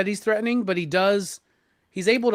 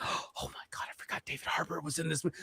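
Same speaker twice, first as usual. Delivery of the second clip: gasped, hushed realisation; awed whisper; 3 semitones lower, 15 dB quieter, faster than usual. Retold or imitated. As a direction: whisper it in mock astonishment. Acting out sudden surprised realisation.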